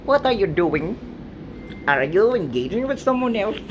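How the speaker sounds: usual speaking voice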